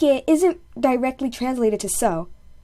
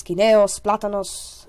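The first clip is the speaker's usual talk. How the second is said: usual speaking voice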